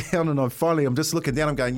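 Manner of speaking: ordinary talk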